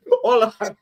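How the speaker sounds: laughing. Real, not acted. Laughing through a restart.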